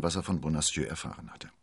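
ordinary delivery, nothing marked

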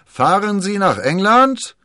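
high, loud hail to a ship; drawn-out dockside holler; calling out. A narrator's reading. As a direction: shout the question slowly across the water. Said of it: "dem Kapitän eines vor Anker liegenden Schiffes zurief".

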